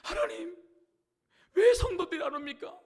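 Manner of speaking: high, loud wailing plea to God; imitated tearful prayer. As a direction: cry out as a pastor begging in tears. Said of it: "막 울면서". (울부짖으며 간구하는 흉내)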